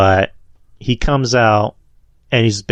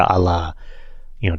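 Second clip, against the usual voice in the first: ordinary talk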